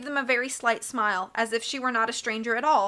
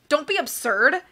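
higher, indignant sputter; 5 semitones higher, 4 dB louder than usual. A narrator's reading. Banker told the children won't go with him, sputtering. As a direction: scoff in mild indignation. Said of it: "Mister Poe sputtered".

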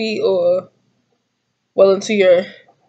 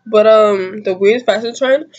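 ordinary talk, nothing marked

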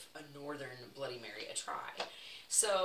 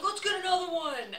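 high, shouted mock Boston accent; rowdy imitation of a Boston drinker; attempted Boston accent; 11 dB louder than usual. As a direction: yell it in a rough Boston accent. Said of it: "I can't do the accent".

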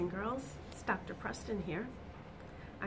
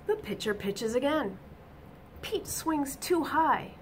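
bright, lively storybook reading; reading to children; 4 semitones higher, 4 dB louder than usual. A narrator's reading. Teacher reading a picture book to children, animated. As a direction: read it out to the little ones with energy.

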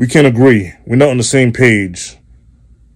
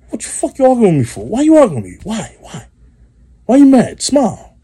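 high, fast exasperated questions; high-pitched rant; 10 semitones higher than usual. Acted. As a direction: squeal the complaints up high. Ranting at arguing partner.

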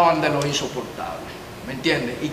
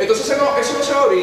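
higher, louder insistence; raised voice; 8 semitones higher, 5 dB louder than usual. Acted. Chief insisting the minor stays, raised voice. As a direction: cut in hard.

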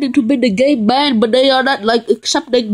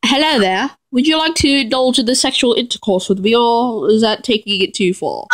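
put-on English accent, mock-polite; hammed-up English gentility. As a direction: proposition someone in a formal English voice. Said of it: "with the English voice".

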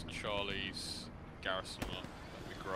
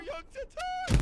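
high, loud hammy wail; man down shout; 4 dB louder than usual. Pretend. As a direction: scream it like a doomed rookie. Hammy dying soldier wail.

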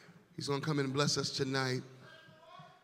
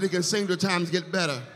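1 louder repeat; 4 semitones higher, 8 dB louder than usual. Real, not acted. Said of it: "I said".

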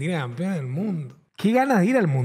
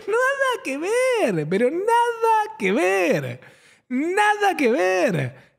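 dismissive; 9 semitones higher, much slower than usual. Retold or imitated. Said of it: "Yo lo escucho y digo".

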